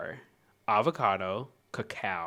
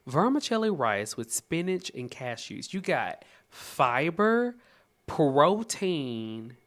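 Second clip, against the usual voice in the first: ordinary talk, nothing marked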